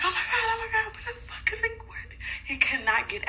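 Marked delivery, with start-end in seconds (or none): 0.0-1.7 high, shrill disbelief at the dog; oh my God, up high in panic; shocked shriek. Retold or imitated. Mother sees her missing dog return, high shocked squeal.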